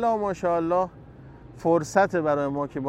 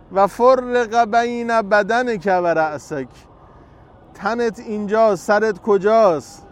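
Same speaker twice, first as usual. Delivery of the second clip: loud, slow mourning chant; lament preaching; 4 semitones higher, 6 dB louder, slower than usual. Acted. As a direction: wail the martyr's wounds to the crowd.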